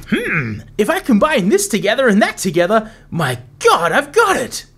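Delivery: hammed-up nerdy scientist, squeaky at the end; giddy potion master discovery. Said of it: "Let's give that a listen back".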